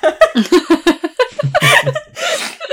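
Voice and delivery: loud high giggling fit at herself; high burst of laughter. Real, not acted. Host laughing at her failed English accent. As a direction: crack up at your own bad accent. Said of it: "Je sais pas le dire".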